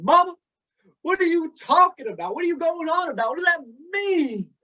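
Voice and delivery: put-on a high, puzzled child's voice; puzzled young boy. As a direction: ask like a confused little kid. Speaker voices his puzzled young self.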